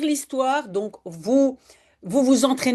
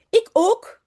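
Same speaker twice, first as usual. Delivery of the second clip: put-on eager child; 10 semitones higher, 4 dB louder than usual. Child character eager in story.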